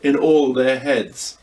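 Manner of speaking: ordinary delivery, nothing marked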